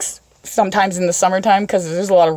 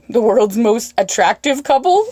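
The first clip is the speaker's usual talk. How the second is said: ordinary talk, nothing marked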